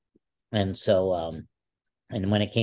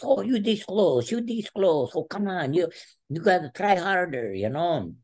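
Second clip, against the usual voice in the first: encouraging coach; 11 semitones higher than usual. Retold or imitated. Coach teasing the wrestler he just toyed with, high and coaxing. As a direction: coax him up high, half teasing. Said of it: "He goes".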